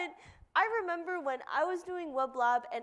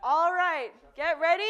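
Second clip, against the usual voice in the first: announcer call; 5 semitones higher, 7 dB louder, slower than usual. Real, not acted. Announcing contest start.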